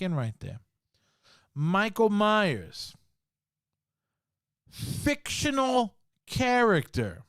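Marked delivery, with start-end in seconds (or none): 1.5-7.2 slow, spaced, fictional character pitched high; driving the point home. Shown as a demonstration. Letting it sink in for his viewers, slow and stressed. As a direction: land each word like a verdict. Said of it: "I'm gonna let that sink in".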